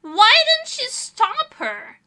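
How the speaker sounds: high, loud, drawn-out accusation; accusing parent. Retold or imitated. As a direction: imitate an outraged parent, stretching the words. Imitates parent blaming a bystander.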